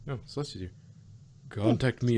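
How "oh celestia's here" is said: plain reading voice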